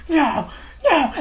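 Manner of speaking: high, imitated cry of refusal; mock horrified protest. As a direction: protest in alarm, half laughing. Acting out her horrified refusal.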